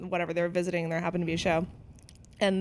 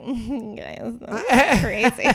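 put-on drunk giggly; 3 semitones higher, 4 dB louder, much slower than usual. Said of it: "and I'd be like".

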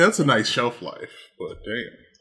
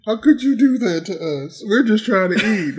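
mock indignation; 6 semitones higher than usual. Acting out the angry cannibals.

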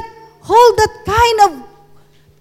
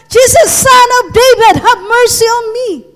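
higher, raised-voice cry; shouted plea; 5 semitones higher, 4 dB louder than usual. Acted. Preacher quoting the blind beggar's shout to Jesus, high crying out. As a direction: call out urgently for mercy. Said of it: "Why he shout".

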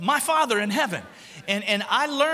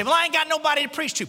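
higher, fast, whiny excuse-maker; mock whiny excuse; 7 semitones higher, 3 dB louder, faster than usual. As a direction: toss off the excuse quickly, higher up. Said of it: "You say".

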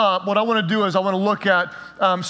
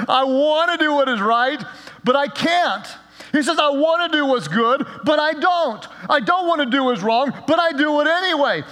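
desperate Paul; 6 semitones higher than usual. Retold or imitated. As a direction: lament like a man with no way out. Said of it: "He sounds like there is no hope".

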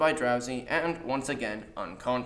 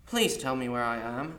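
ordinary delivery

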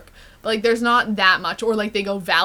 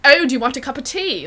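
British accent in own voice; 4 semitones higher, 4 dB louder than usual. Shown as a demonstration. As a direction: do the British accent without going squeaky. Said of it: "in my actual voice".

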